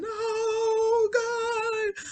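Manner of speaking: very high, crying wail of terror; mock sobbing scream in high pitch; terrified screaming. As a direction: scream as if being dragged away. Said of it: "he's screaming".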